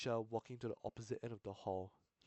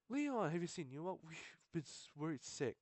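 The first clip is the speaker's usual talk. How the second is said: put-on higher-pitched worried girl's voice; voiced female student; 6 semitones higher than usual. Student council president asking Leon about Yuma, anxious girl voice. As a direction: ask with a lifted, anxious girl's voice.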